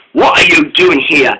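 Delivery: plain reading voice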